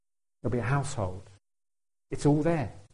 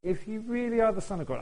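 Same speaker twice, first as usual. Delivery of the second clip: higher, quieter sly challenge; the devil's taunt; 8 semitones higher, 4 dB quieter than usual. Pretend. Devil taunting Jesus in the wilderness, sly. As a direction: needle him like a tempter. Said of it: "And so the devil says".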